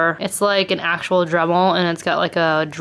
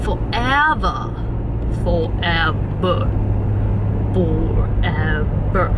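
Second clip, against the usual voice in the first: slow dramatic movie quote; 2 semitones lower, much slower than usual. Retold or imitated. Vlogger imitating a movie line, slow and drawn out. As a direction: stretch it out like a film close-up. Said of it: "What movie is that from?".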